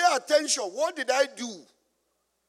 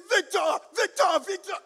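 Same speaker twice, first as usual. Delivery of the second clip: retold panicked calls of Victor, rising higher each time; mock scream; 6 semitones higher than usual. Examiner shouts at the student driver speeding through amber, a mock scream. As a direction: call the name out like an alarmed examiner. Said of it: "the man was screaming".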